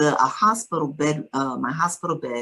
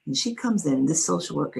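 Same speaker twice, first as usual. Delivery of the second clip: ordinary talk, nothing marked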